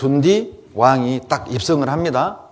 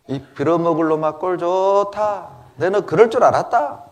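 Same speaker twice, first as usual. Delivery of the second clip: put-on gloating Jeolla-accented taunt, pitched up; Jeolla dialect; 5 semitones higher than usual. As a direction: curse like a Jeolla local. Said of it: "전라도 버전으로 번역할게요".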